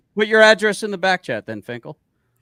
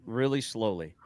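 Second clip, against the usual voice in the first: slow, softer, measured explanation; deliberately slow; 5 semitones lower, 7 dB quieter than usual. Real, not acted. Telling Cliff he can mute himself, really slowly. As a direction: spell it out slowly, keep it quieter. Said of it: "gonna say this really slowly".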